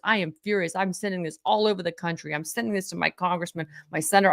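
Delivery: ordinary talk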